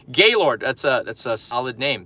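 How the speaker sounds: normal talking voice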